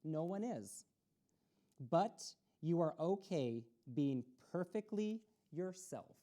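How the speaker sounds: ordinary delivery, nothing marked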